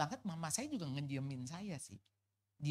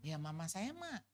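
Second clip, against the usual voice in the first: normal talking voice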